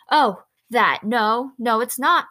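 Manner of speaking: head her off at once, emphatically; alarmed, insistent no; quick, urgent. A narrator's reading. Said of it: "he said quickly".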